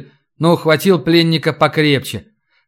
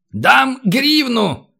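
high, very slow stretched-out offer; grudging drawn-out high call; shouted bid; 9 semitones higher, much slower than usual. Acted. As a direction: holler your price, dragging each word. Haggling shouted up to window.

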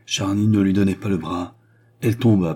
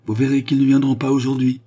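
raised, louder impatient outburst; 6 semitones higher, 5 dB louder than usual. A narrator's reading. Queen fretting to Charny that nobody will come, impatient. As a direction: complain with mounting impatience. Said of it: "frappa du pied avec impatience".

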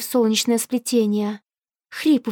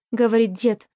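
ordinary delivery, nothing marked

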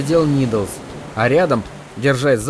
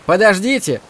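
high shouted call; shouting; 6 semitones higher than usual. A narrator's reading. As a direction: yell after them to wait. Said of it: "крикнул Кори".

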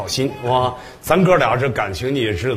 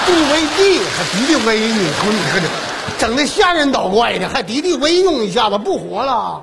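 incredulous exclaim; 10 semitones higher than usual. Acted. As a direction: blurt it out in comic disbelief. Host mocks pesticide mix-up.

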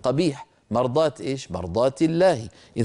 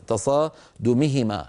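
ordinary delivery

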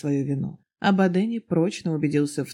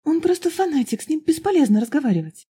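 put-on high, eager young woman pouring out her story; young girl's voice; 10 semitones higher, 5 dB louder than usual. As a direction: pitch it up high, breathless and earnest. Girl's voice, louder. (высокий взволнованный девичий голос)

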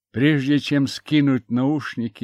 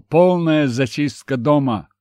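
loud, firm command to clear the house; commanding order; 4 semitones higher, 6 dB louder than usual. Acted. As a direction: bark the order at the soldier. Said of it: "скомандовал".

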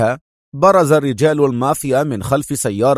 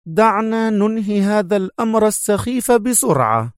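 much higher, brisk woman; female major voice; 9 semitones higher than usual. Acted. The major brushing off the inspector, higher and brisk. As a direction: dismiss the matter briskly. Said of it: "وقالت".

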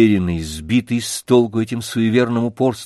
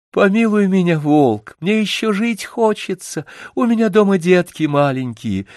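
higher, lightly voiced pleading little hare; 8 semitones higher than usual. A narrator's reading. Hare begs the wolf to spare him, higher pleading. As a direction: beg the wolf for mercy. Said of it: "Заяц стал проситься".

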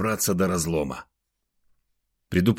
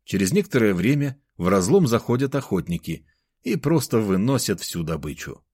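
ordinary delivery

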